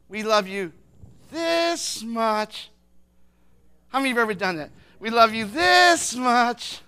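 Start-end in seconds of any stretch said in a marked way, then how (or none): 0.1-2.7 drawn-out, stretched declaration of love; doting grandparent. Retold or imitated. Grandparents telling a little kid their love, exaggerated fondness. 5.0-6.8 loud, very slow, stretched declaration; exaggerated doting. Grandparents telling a child their love, big drawn-out fondness. Grandparent's phrase repeated louder.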